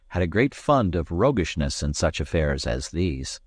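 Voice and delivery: usual speaking voice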